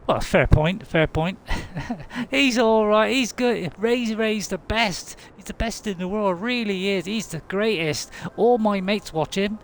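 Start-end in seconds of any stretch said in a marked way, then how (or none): 2.3-9.6 high-voiced gushing admirer; eager fan character. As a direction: gush in a high put-on voice. Plays an admiring fan figure.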